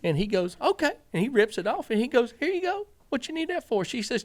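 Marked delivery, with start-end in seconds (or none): 0.6-0.9 much higher mock-innocent okay; imitating Pops. Pops agreeing to Teresa, high and innocent. 2.4-3.8 much higher, innocent helper voice; imitating Pops. Pops handing Teresa tape, high and naive.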